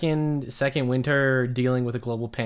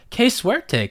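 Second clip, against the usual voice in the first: high-pitched narrated exclamation; crying out; 11 semitones higher, 4 dB louder than usual. A narrator's reading. Amumu exclaims in a nonsense story, crying out. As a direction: cry it out high, as a story character. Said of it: "cried Amumu".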